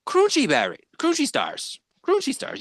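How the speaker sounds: high-pitched attempt at the Swedish Chef accent. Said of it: "You have to do the accent".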